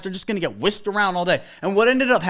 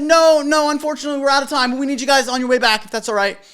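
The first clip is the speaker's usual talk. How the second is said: firm polite refusal; 5 semitones higher, 4 dB louder than usual. Retold or imitated. DJ firmly but politely refuses.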